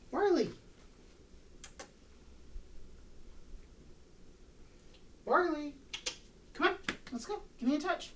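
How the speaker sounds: energetic high call. Real, not acted. Trainer keeping the sluggish dog's energy up, high and eager. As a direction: pump up the energy so he stays keen. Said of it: "keep the energy going".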